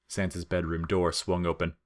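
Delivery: usual speaking voice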